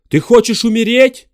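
loud high desperate question; shouting. Acted. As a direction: yell it down at her. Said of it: "крикнул он".

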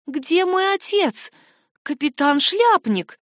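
put-on high, slow, fearful little girl; thin, frightened voice. A narrator's reading. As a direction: ask in a small scared voice. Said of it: "тонким и дрожащим от страха глазком". (тонкий испуганный голосок)

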